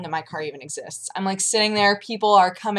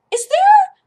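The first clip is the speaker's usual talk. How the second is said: very high, louder sweet asking voice; high chirpy overpolite question; polite customer voice; 8 dB louder than usual. Retold or imitated. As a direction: put on a nice voice for the manager. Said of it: "I walked over to her and I was like".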